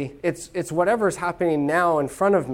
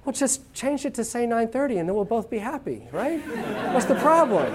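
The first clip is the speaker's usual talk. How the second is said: carefree Italian; 7 semitones higher than usual. Pretend. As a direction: laugh off the problem lightly. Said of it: "And I'd say".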